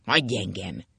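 low, curt push-away; gruff rejection. A narrator's reading. Husband pushing away his wife's embrace, low and gruff. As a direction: growl her off. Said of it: "Ákos ellökte".

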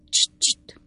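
urgent unvoiced shush; hushing whisper. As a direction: hush him sharply in a whisper. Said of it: "kiáltotta Pott úr". (sürgető suttogás)

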